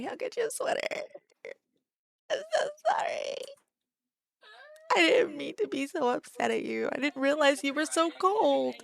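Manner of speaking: high, loud hammed-up wailing sorry; mock sobbing apology. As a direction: overact a tearful apology. Cry shout, much higher, louder.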